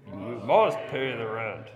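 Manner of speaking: low, loud menacing villain voice; mustache twirling villain. Shown as a demonstration. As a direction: sneer it like a melodrama villain. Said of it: "all the men".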